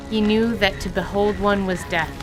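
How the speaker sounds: ordinary talk, nothing marked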